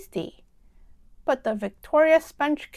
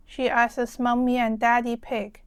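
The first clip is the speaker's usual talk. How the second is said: usual speaking voice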